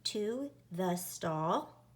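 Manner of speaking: slow, word by word; spaced dictation read. Shown as a demonstration. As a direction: say each word slowly for dictation. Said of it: "let's say it together and let's count how many words".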